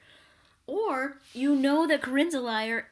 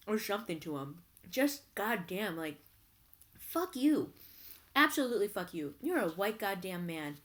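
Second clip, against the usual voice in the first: normal talking voice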